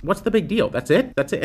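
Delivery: high, incredulous scoff; mock dismissive. Mocking an unimpressed viewer, incredulous scoff. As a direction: scoff like it's no big deal. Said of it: "it's like".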